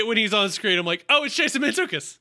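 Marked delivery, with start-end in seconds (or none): 1.1-2.2 high-pitched delighted recognition; excited recognition. Retold or imitated. Co-host reenacts spotting the actor onscreen, excited. Acts out own reaction on seeing actor.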